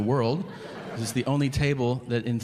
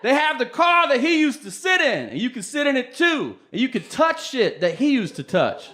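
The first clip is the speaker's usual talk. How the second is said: mock-excited, much higher and louder, laughing; excited fan; 13 semitones higher, 5 dB louder than usual. Fan gushing to the comedian about the museum, squealing high. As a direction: gush about it like a superfan. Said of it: "And he goes".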